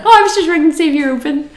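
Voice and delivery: high, fast and loud; eager. Retold or imitated. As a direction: gush it out hopefully. Retold hopeful phone call.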